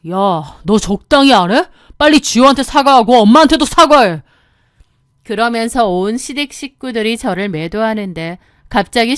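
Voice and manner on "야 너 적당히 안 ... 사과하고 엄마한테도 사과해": loud husband demanding; angry order. Acted. Husband ordering wife to apologize, angry.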